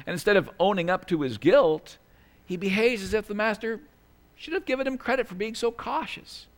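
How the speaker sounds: normal talking voice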